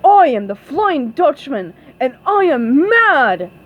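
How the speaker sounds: loud, emphatic booming voice; booming Flying Dutchman. A narrator's reading. Flying Dutchman bursts in angry, loud booming voice. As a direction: boom it out with heavy angry stresses. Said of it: "in a booming voice".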